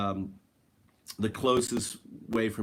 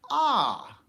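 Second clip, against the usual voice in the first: high surprised gasp; 12 semitones higher, 5 dB louder than usual. Retold or imitated. Sudden realization on a trip.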